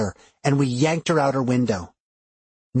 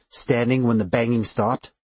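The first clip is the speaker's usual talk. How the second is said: plain reading voice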